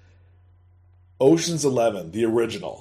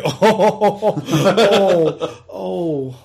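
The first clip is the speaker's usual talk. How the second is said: mock laughing; 5 semitones higher, 4 dB louder, much slower than usual. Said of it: "and you're like".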